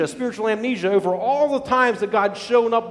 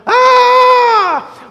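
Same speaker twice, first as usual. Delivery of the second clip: high shouted cry of panic; panicked scream; 13 dB louder than usual. Retold or imitated. Preacher imagining Jesus screaming in panic too. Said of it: "Jesus on the other side going".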